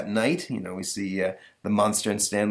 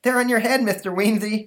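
put-on high, louder lisping kid; helpful boy Stanley; 14 semitones higher, 5 dB louder than usual. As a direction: pipe up like a schoolboy. Boy pipes up to absent-minded teacher.

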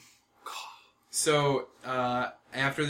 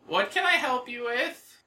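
raven voice; 12 semitones higher, 5 dB louder than usual. Acted.

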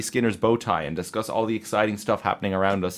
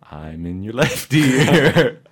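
slow, louder panned voice; whisper in left ear; 3 semitones higher, 9 dB louder, much slower than usual. Shown as a demonstration. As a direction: speak slowly into the left ear. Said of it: "We can play with the pan".

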